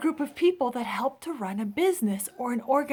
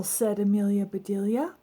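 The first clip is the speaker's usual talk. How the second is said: plain reading voice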